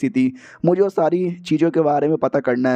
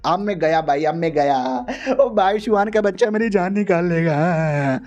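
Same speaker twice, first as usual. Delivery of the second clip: mock crying; slower than usual. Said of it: "money मन रो रहा था".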